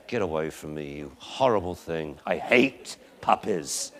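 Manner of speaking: put-on low, grumbling old-dog voice; crotchety old dog. Old dog telling off a puppy, crotchety low grumble. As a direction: grumble it low and quiet, dragging out the end. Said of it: "some crotchety old dogs that just say".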